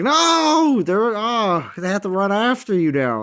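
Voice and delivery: high startled cry sliding down; retold alarm. Player retells his raid panic, high startled yelp. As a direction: yelp as the troops get shoved away. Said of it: "and I was like".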